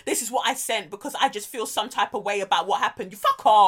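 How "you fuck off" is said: loud, indignant dismissal; retold angry retort. Cohost answering [name]'s shared text, retold angry retort. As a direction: throw the retort out loudly.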